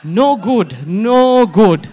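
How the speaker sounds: high, very loud dismissal; loud disapproval. Retold or imitated. Wife judging her husband's kiss, loud mock disapproval.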